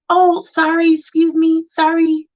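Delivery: high, bright little apologies; imitating her toddler saying sorry; mock apologetic toddler. Mother imitating her two-year-old's apologetic voice. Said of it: "she was like".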